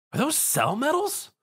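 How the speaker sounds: high, startled yelp. A narrator's reading. Tom sees the coins and yelps in high alarm. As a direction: blurt it out in alarm. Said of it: "Tom yelped".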